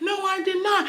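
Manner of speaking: high, drawn-out mimicked protest; girlfriend's denial. Girlfriend denying cheating to boyfriend, high mock protest. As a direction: imitate her denying it in a high voice.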